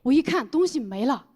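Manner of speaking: usual speaking voice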